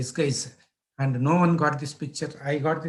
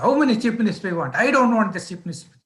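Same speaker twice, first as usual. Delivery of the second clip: fast, higher-pitched angry retort; mad chief sub; 5 semitones higher, 4 dB louder, much faster than usual. Retold or imitated. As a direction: act out an irate boss rushing his dismissal. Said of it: "he was mad that day".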